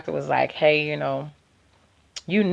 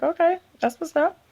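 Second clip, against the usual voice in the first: mock casual; 11 semitones higher, 3 dB quieter than usual. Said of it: "I'm like".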